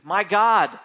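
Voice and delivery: loud, high desperate cry; Jesus on the cross screaming to God; anguished scream. Acted. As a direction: scream it to the heavens in pain. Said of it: "he screamed".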